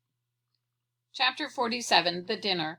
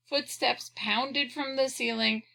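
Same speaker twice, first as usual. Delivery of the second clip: ordinary delivery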